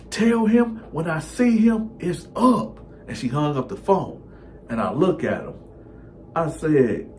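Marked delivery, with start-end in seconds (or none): 0.1-2.8 put-on girlfriend's threat.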